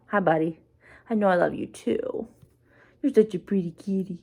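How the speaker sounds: hushed and tender, cooing to the cat; soft pet talk. Real, not acted. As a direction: sweet-talk the kitty softly. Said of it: "if I start talking like that".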